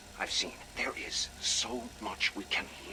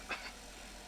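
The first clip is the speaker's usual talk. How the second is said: a quiet pleased little laugh under the breath; soft chuckle; 11 dB quieter than usual. Acted. Scientist amused by a rival's success, soft chuckle. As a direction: chuckle softly to yourself.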